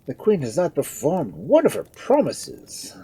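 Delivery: high, loud lament of a doctor; aggrieved councillor's moan; groaning complaint. A narrator's reading. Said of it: "groans Doctor Hardman".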